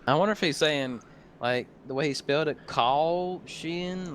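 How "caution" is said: put-on Southern accent. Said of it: "our accents talk like that".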